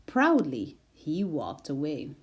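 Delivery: ordinary delivery, nothing marked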